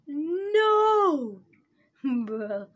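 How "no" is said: high, loud cry of dismay; dismayed shout. Real, not acted. Player yelling at the game as it goes wrong, high dismayed shout. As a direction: yelp No at the screen.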